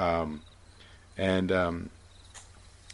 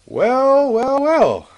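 much higher, louder, drawn-out villain greeting; sly villain; 8 dB louder, much slower than usual. Acted. The buyer strolls up to the party, sly and smug. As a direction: savor it like a smug schemer. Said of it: "And he's like".

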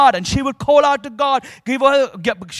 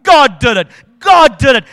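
louder rising praise shout; woman outshouting her atheist neighbor; 9 dB louder than usual. Retold or imitated. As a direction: shout it out over him. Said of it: "she shouted out even louder".

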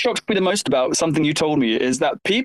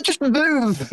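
Clarkson grumble; 6 semitones higher than usual. Retold or imitated. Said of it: "I feel like Jeremy Clarkson now".

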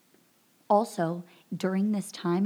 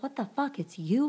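freaking out; 7 semitones higher than usual. Retold or imitated. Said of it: "freaking out".

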